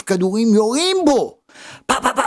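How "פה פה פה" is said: rapid high popping bullet sounds; mimicked gunfire. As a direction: fire off three high-pitched shots with the voice. Imitates the bullets being fired. (חיקוי יריות בקול גבוה)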